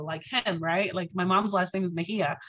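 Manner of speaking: ordinary delivery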